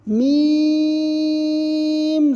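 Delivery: long sung-out میم held on one steady note; drawn-out letter. Shown as a demonstration. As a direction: chant میم slowly like recitation. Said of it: "teacher demos letter recitation".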